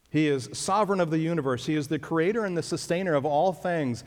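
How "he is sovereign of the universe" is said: normal talking voice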